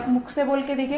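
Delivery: usual speaking voice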